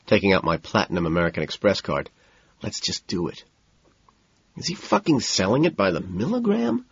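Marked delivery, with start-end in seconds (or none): 2.6-3.4 soft hushed urging; quiet whisper to a nervous friend. A narrator's reading. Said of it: "I whisper". 4.6-6.9 incredulous. A narrator's reading. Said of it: "Price asks".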